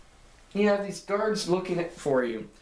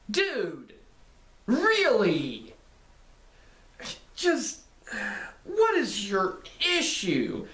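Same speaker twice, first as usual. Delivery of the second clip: high-pitched, incredulous retort; mock-appalled dressing-down; exasperated disbelief; 5 semitones higher, slower than usual. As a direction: call him out, word by exasperated word. Exasperated retort to game villain.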